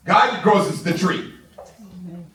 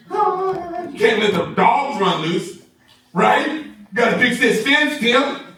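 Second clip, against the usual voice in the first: mock scolding; 6 semitones higher, much slower than usual. Mocking the excuse-maker.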